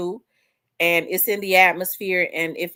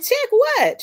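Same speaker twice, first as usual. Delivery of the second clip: much higher, quick confused question; puzzled reply; 5 dB louder than usual. Retold or imitated. Retelling her own confused answer to her friend, high and surprised. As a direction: react as if caught off guard.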